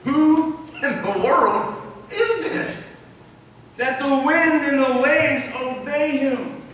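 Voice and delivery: high, drawn-out, stunned question; awed terror. Acted. Terrified disciples asking one another, awed and stunned. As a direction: stretch each word in hammed-up disbelief. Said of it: "They were terrified".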